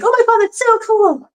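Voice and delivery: high, gushing fake excitement; mock gushing. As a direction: squeal it like an over-eager fan. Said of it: "Everybody in here is gonna be like".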